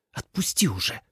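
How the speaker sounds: irritated hiss, held down. A narrator's reading. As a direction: hiss it impatiently. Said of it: "зашипела Суэлань".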